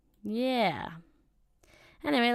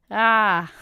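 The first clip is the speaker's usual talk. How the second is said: loud startled scream; full-throated scream of shock; panicked scream; 10 dB louder than usual. Acted. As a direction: shriek in sudden panic. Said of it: "I didn't know I was capable of that kind of volume".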